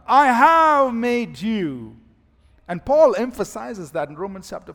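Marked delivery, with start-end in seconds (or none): 0.1-2.0 slow "I have made you" with HAVE hit hard; "have" pressed down, words drawn out; stress on HAVE. Shown as a demonstration. Preacher repeating God's words to Abraham, HAVE stressed. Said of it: "speaking as though the work is already done".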